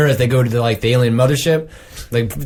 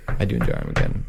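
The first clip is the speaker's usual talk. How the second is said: deep breathy whisper; hushed, guilty admission in a low whisper; low whispered confession; 13 semitones lower, 6 dB quieter than usual. Acted. Confessing love for Armageddon.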